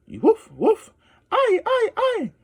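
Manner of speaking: high yelping howl, hammed up; wolf howl. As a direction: howl like a kid playing a wolf. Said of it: "He was a terrific growler".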